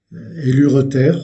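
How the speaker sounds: normal talking voice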